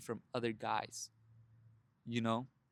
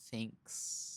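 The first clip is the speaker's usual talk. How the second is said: cold breathy throwaway thanks; dismissive; 3 semitones lower, 9 dB quieter than usual. Retold or imitated. Lady brushing off a stranger's compliment, cold and dismissive. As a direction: brush him off with one word. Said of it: "she looked at me and was like".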